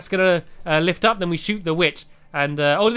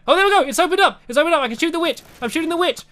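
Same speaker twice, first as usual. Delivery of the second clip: high excited chanting; 8 semitones higher, much faster than usual. Real, not acted. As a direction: rattle it off thrilled. Door opens, excited shooting.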